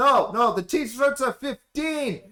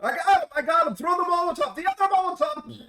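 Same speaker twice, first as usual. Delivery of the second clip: excited yelling; 9 semitones higher, 3 dB louder than usual. Acted. NPC yelling in a fight.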